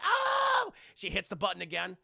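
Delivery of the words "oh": hammed-up startled shriek, very high and loud; mock scream. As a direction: shriek in fake fright. Heroine startled again.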